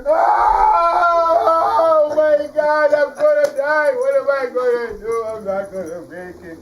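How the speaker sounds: high, loud panicked wailing; hurt lion panicking over a thorn; lion in pain. Acted. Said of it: "And he goes".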